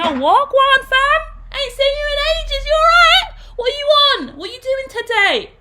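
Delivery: very high, loud London roadman greeting; loud high London street-slang greeting to old friends. Shown as a demonstration. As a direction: hail a mate from the ends in London slang. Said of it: "in the ends that I grew up in".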